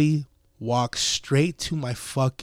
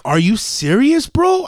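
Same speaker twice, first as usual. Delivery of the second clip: raised pitch, jumping up on "bro"; incredulous challenge; 8 semitones higher, 5 dB louder, slower than usual. Retold or imitated. Driver to cop asking about parking, loudly incredulous. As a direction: throw it back at him in disbelief. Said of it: "I tell him just like this".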